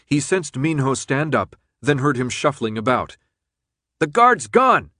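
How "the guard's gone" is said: high, loud urgent shout. A narrator's reading. Friend calling through the dark to the others, shouted. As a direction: shout it out over the alarm. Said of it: "his friend shouted".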